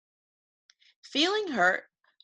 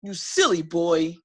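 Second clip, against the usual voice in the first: angry yell; 2 semitones lower, 5 dB louder than usual. Acted. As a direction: roar it at the boy like an angry dragon. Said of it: "Joe yelled".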